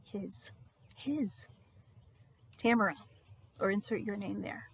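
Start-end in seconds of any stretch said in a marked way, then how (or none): 0.1-1.5 very soft, single word set apart; hushed awe. Real, not acted. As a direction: breathe it out in reverence. Hushed declaration of belonging.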